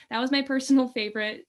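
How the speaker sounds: giggling as she admits her favorite; laughing. Real, not acted. Actor recalls her favorite role to the host, laughing.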